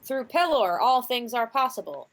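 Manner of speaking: a hint of a priest blessing the table; head clergy's voice. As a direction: intone it like a pious cleric. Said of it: "in the head clergy's voice".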